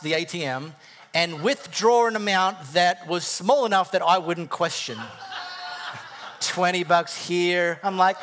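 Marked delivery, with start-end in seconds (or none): 5.0-6.3 airy, high-pitched giggle; high breathy laugh. Real, not acted. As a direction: let the laugh squeak out. Laughs at own joke.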